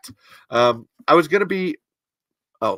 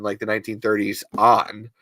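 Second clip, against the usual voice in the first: normal talking voice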